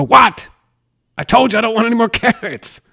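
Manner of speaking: high, loud exasperated snap; hammed-up outburst with an explosive start; losing your cool. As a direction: blow up in mock fury. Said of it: "you snap and you just fucking lose your cool".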